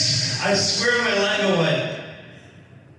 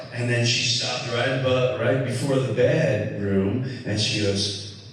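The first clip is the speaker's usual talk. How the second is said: ordinary delivery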